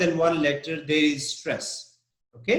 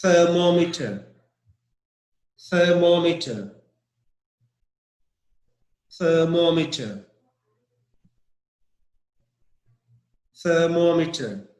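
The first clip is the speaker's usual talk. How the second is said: "thermometer" stressed on the second syllable, MOM. Shown as a demonstration. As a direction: hit MOM in "thermometer", then fall away. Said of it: "The stress is M O M".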